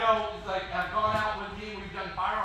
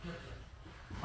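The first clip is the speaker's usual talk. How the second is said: put-on breathy, hushed "get, get" of an impatient kid; eager whisper; 4 semitones lower, 16 dB quieter than usual. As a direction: whisper it, itching to grab the bow. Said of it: "I'm just like".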